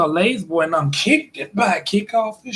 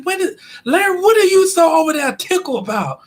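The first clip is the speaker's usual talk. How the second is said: high, louder teasing question; 10 semitones higher, 3 dB louder than usual. Retold or imitated. Miss [name] asking [name] why he laughs, high teasing voice. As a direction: pitch it way up and needle him. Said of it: "Little Miss [name], she talking".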